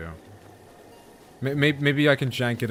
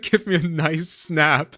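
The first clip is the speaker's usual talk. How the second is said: slow, higher, laughing imitation; mock researcher; 7 semitones higher, 5 dB louder, slower than usual. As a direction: quote her in a hammed-up voice. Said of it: "she's like".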